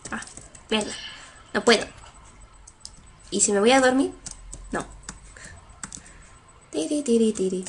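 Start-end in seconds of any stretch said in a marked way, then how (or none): none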